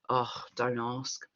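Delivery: sighing "Oh, don't ask." meant to invite concern; heavy, withdrawn "Oh, don't ask.". Shown as a demonstration. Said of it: "maybe they do want you to ask".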